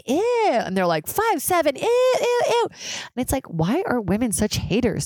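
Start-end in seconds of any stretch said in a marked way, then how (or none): 0.1-0.5 very high squealed ew; exaggerated, high-pitched revulsion; mock disgust. Mimicking women disgusted by short man. 1.1-2.7 very high, incredulous squeal; exaggerated high-pitched revulsion; mock disgust. Mimicking women disgusted by short man.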